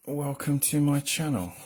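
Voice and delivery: ordinary talk, nothing marked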